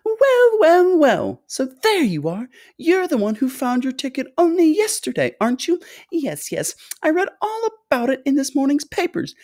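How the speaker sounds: high, bubbling, effusive chatter; delighted high-pitched host; excitable fluty factory owner. A narrator's reading. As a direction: fuss over the guests in a piping voice. Story figure: fluty factory owner welcoming.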